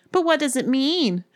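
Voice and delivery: high-pitched anxious question; disbelieving. A narrator's reading. Ellen asking Erin to explain, in disbelief. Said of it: "looked at each other in disbelief".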